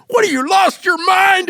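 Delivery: high, loud exasperated shout at a worker; frustrated yell. Retold or imitated. Boss finally exploding at an unaware employee, loud exasperated yell. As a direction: blow up in mock frustration, slow and loud. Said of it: "I was frustrated and going".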